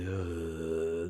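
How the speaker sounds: low, soft drawn-out groan; imitated groan. Artists told why the gate stays open, low mock groan. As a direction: groan like a stumped crew.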